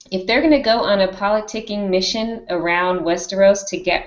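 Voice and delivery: ordinary delivery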